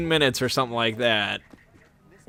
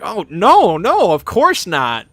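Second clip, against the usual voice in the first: flustered waitress; 4 semitones higher, 4 dB louder, slower than usual. Retold or imitated. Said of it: "She was like".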